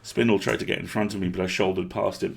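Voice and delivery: ordinary delivery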